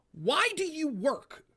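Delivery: high, slow, dopey imitation of the caller; mock caller voice. Host mimicking the caller asking why he works, high and slow. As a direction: drag it out high like a puzzled caller.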